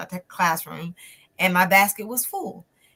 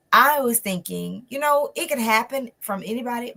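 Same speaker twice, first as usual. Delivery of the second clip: usual speaking voice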